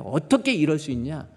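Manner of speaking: rebuking. Retold or imitated. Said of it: "하고 책망을 하고요".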